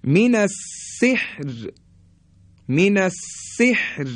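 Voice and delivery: من السحر drawn out slowly, the ر kept thin; slow melodic Quranic recitation; recited example. Shown as a demonstration. Teacher recites a tajwid example, slow and melodic. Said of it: "یا".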